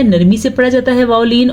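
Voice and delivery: ordinary talk, nothing marked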